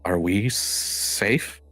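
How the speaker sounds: slow, hesitant question, lightly voiced farmer; worried farmer. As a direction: ask it slowly, uneasy about the scarecrow. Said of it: "he goes".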